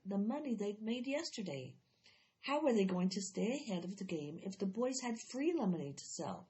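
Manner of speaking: usual speaking voice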